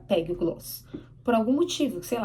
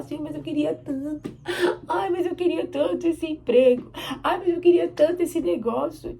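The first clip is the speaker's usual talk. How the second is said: whiny, higher imitation of begging; mock sad pleading; 5 semitones higher, 4 dB louder than usual. Believer told no by the Lord, mock whining plea. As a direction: plead like a disappointed child, hammed-up. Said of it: "a gente fica triste".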